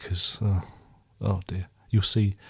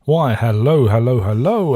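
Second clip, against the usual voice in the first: a hint of a hammed-up host voice, louder; a louder, hammy host hello; [name]'s greeting; 2 semitones higher, 8 dB louder than usual. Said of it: "Over to you, [name]".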